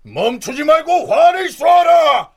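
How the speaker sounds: rally the troops at full roar; roaring rallying speech. Acted. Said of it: "권율이 병사들을 독려하였다".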